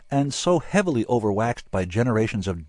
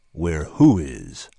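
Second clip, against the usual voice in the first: slow, low rumbling question; ominously patient rumble; 3 semitones lower, 5 dB louder, much slower than usual. A narrator's reading. Bill questioning his rambling friends, ominously patient. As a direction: rumble like an idling locomotive. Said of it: "His voice sounded like a locomotive idling".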